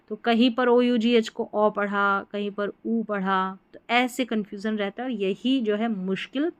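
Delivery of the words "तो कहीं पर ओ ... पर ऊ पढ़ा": ordinary delivery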